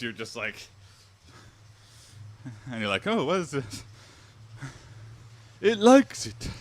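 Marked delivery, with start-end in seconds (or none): 3.1-6.5 mock surprise. Voicing surprised wolf tamer.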